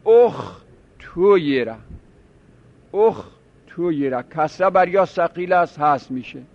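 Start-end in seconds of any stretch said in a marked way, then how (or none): 0.1-1.8 اخ تو یره spelled out slowly in three separate chunks, اخ then تو then یره; syllable-by-syllable demo. Shown as a demonstration. Teacher to students, spelling out the syllables slowly. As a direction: break اخ تو یره apart slowly so each syllable stands alone. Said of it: "ضم اول هم اینجا هست".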